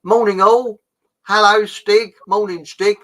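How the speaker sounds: loud, high hello; called-out greeting. Real, not acted. Host greeting Stig from New Zealand, loudly. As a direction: call out cheerily to the listeners.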